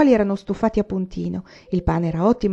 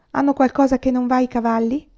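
higher, alarmed question; worried sick about the horses; frightened exclaim; 6 semitones higher, 3 dB louder than usual. A narrator's reading. Ma asking Pa about the horses, frightened. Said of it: "esclamò mamma spaventata".

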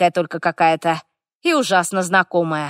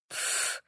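short dismissive puff of breath; soft, airy snort of contempt; scornful snort; 16 dB quieter than usual. A narrator's reading. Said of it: "фыркнула огненная". (презрительный фырк)

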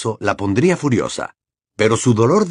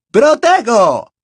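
high, loud drawn-out incantation; shouted spell; 13 semitones higher, 6 dB louder, much slower than usual. Acted. Harry shielding himself from Bellatrix's curse, shouted spell. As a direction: cast it with a loud cry.

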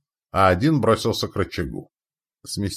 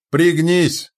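high loud warning shout; 9 semitones higher, 7 dB louder than usual. A narrator's reading. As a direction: yell for him to duck. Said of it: "прокричал Митяй".

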